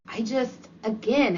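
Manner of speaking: ordinary delivery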